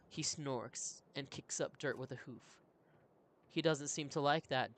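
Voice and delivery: ordinary talk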